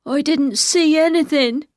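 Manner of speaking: high, loud, slow voice of a scared simple man; Ben, frightened. Acted. Ben backs to the wall near tears, high and frightened. Cornered Ben, about to cry.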